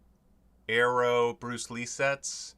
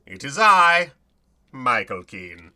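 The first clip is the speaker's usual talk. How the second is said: loud, grandly announced name; hint of a mock-heroic host; grand self-announcement; 11 dB louder than usual. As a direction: proclaim your own name. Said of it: "I say my name like that".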